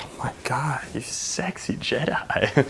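slow, unvoiced imitation of an admirer; mock swooning whisper. Imagined admirer reacting to his Jedi costume, mock swooning whisper. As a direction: whisper it slowly in mock awe.

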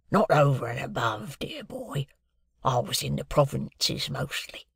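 put-on hushed old convict voice; a rough convict, keeping it hushed; Magwitch. A narrator's reading. Convict answers.

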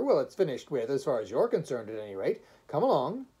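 loud, impatient dismissal; loud, bossy driver; brusque driver. A narrator's reading. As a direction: cut off all argument and hurry them out.

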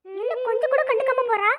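crying complaint. Acted. Girl crying as her friend walks past, plaintive hammed-up wail. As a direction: whine tearfully for some attention. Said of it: "இங்க ஒருத்தி அழுதுகிட்டு இருக்கிற".

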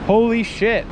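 high, loud burst of real amazement; surprised exclamation. Real, not acted. Vlogger amazed at the cyclists' journey, loud surprised outburst. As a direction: blurt it out, floored by the news.

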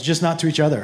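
low, offhand admission; deadpan confession. Retold or imitated. Married man admitting the affair to comic, low deadpan. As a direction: drop it casually in a low voice. Acts the guy's sly confession.

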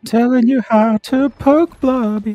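high, loud squeaky blob; loud Blobby voice. Pretend. Streamer squeals as Blobby.

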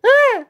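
sudden high, loud yelp; exclaimed yelp. Acted. Reading out the exclamation point, a high yelp. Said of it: "there was a dot, dot, dot, and an exclamation point".

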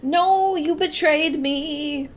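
high, drawn-out mock lament; hammed-up wail of betrayal. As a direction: play up the betrayal, stretching it slow. Mock dramatic reaction to game.

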